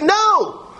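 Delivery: preacher voicing the psalmist's protest, a sudden high cry; sharp high cry. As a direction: fling out the "No!" in a burst. Climax of the voiced plea.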